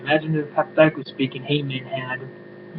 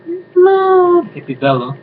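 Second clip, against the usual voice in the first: much higher, louder, stretched slow mock name; 7 dB louder, much slower than usual. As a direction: sing out the nickname high and slow to mock her. Mocking nickname in list.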